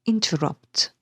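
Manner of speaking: "interrupt" weighted on RUPT; two light syllables, then RUPT in "interrupt"; stress on last syllable. Shown as a demonstration. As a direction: hit the end of "interrupt". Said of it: "the stress is on the very last syllable".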